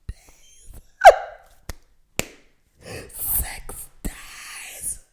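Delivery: breathless laughing whisper of disbelief; stunned, laughing whisper; incredulous laugh. Real, not acted.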